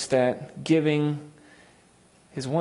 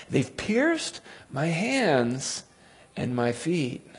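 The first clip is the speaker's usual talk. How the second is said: slow dramatic reading; 2 semitones higher, 5 dB quieter, much slower than usual. Acted.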